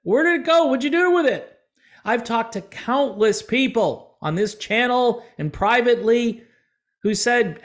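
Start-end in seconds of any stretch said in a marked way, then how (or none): 0.0-1.6 high, fast mock outrage, louder; fast, high cry of betrayed fans; indignant fans. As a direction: play the fans demanding their music back. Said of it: "The fans were like".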